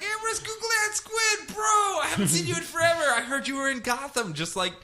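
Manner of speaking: very high, excited surfer bro; high-pitched dude greeting. Retold or imitated. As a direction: greet your old buddy in a high bro voice. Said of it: "And he's like".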